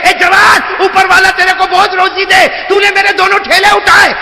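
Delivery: fervent high preaching shout; preaching at full force. Acted. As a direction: shout the blessing out to the crowd. Full-force preaching, old woman's blessing.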